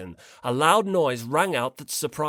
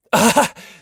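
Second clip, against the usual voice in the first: laugh; 8 semitones higher, 11 dB louder than usual. Acted. Komaeda laughs at the argument.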